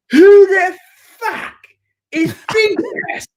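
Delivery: high, loud incredulous blast, broken by pauses; mock outraged shout. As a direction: explode word by word as the furious groom. Groom's outrage, retold.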